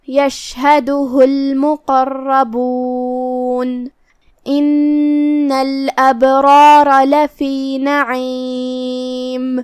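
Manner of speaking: very slow, long held notes in tajweed chant; teacher's slow melodic recitation; Quran recitation. Shown as a demonstration. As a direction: intone the verse, stretching every vowel. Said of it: "کلمت ان میں الف کے نیچے زیر ہے".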